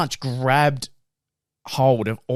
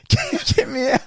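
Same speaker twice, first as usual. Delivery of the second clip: panicked scream. Retold or imitated. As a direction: scream to get out of the van.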